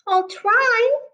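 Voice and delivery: high little duckling voice; high, eager little duck; Daisy the duckling. Acted. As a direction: pipe it up high like a small duckling. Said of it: "said Daisy".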